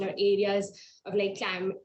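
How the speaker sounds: usual speaking voice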